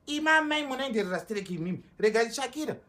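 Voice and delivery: loud, heated telling-off; angry scolding. Acted. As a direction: scold her loudly. Sibling angrily scolds mother.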